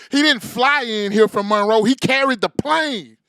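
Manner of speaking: loud, slow, drawn-out announcing; hype announcement. Acted. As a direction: bellow it like a ring announcer. Hype man announcing guest.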